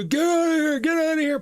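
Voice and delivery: high-pitched pestering yell; blustering little manager. Retold or imitated. Manager poking a big rig worker, blustering high-pitched yell. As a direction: rattle it off high, a small man acting tough.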